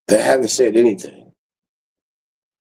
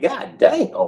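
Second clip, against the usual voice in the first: much higher astonished exclamation; amazed; 12 semitones higher, 4 dB quieter than usual. Retold or imitated. Viewers seeing how much water he drinks, high amazed. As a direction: gasp it out in disbelief.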